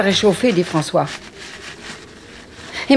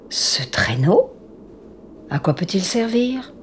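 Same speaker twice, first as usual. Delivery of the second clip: slow hushed whisper; 7 dB quieter, slower than usual. Acted. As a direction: whisper it, puzzled and wary. Children exploring strange house.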